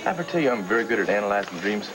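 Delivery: hammed-up German analyst accent; bad German accent. Teasing partner about analyzing dreams, mock German accent. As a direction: play a dream doctor with a poor German accent. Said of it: "That's the worst German accent".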